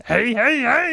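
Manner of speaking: gravelly imitation of a goofy guy; rough, drawn-out cheerful greeting; gravelly Albert voice. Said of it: "he has that sort of gravelly voice".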